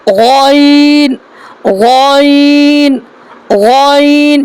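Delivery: غین held long from the back of the throat; deliberate, drawn-out غین; drawn-out letter demo. Shown as a demonstration. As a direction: model each غین slowly. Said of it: "وہیں سے نکالنا ہے".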